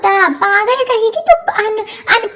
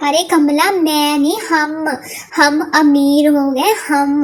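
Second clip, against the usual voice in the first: a lower, grumbling sidekick voice; low-voiced partner; 8 semitones lower than usual. Partner nagging Kamla about the screwdriver, lowered voice.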